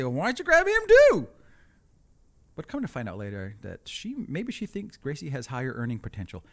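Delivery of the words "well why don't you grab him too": high, loud exasperated outburst; exasperated retort. Retold or imitated. Husband retorts to wife about grabbing the toddler, high loud mock exasperation. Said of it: "I wanted to say".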